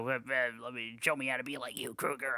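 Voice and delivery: lightly put-on child doing a movie villain; half-hearted hammy villain mimicry; kid's bad villain voice. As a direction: mimic a kid's clumsy Freddy imitation. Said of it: "seeing a little kid just go".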